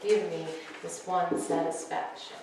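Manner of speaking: soft, low spoken-word delivery; hushed, sensual poetry reading; poem reading. Acted. Reads her poem at open mic.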